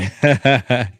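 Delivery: short amused chuckle; quick laugh to himself. Real, not acted. Host amused by his own spelling aside, laughing.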